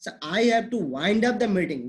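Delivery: very slow, wind with long ai; slow example. Shown as a demonstration. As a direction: spell out wind up with ai at half speed. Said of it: "meeting को मुझे खत्म करना है".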